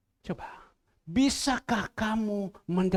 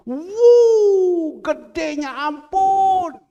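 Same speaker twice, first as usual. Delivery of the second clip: high, loud astonished gasp; amazed; 10 semitones higher, 13 dB louder, much slower than usual. Retold or imitated. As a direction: marvel at how huge it is. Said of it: "Yang dia tulis itu".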